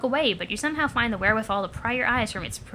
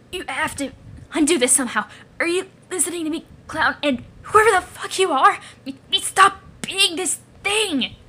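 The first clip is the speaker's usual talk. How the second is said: desperate demand; 4 semitones higher than usual. Acted. Creature begging the clown to undo this, desperate. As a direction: demand it frantically, stretching the last words.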